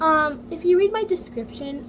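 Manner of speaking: ordinary talk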